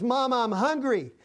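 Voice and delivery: put-on hungry child. Preacher voicing a starving child asking mama for food, a light plea. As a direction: let a little child beg his mama for food.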